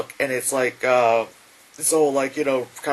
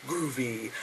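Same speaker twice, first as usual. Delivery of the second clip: cool cameo line; 2 semitones lower, 11 dB quieter than usual. Retold or imitated. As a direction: toss it off softly to camera. Quoting hero's cameo line.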